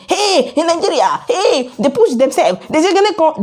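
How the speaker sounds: high, laughing mock shout; mock shouting onlookers. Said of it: "people will now be shouting".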